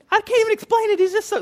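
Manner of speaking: exaggerated high-pitched girlish gushing. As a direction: play a smitten girl lost for words. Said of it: "that's my girl voice".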